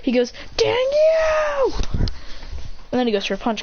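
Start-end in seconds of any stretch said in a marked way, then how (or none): 0.6-1.7 high, strained cartoon yell; acted shout. Beaten rival curses his opponent, high mock shout. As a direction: cry it out in mock outrage, way up high.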